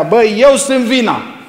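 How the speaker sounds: hammed-up marital yell, EU punched; mock shout at wife. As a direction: yell it at your wife to win the fight. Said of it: "țipi la ea".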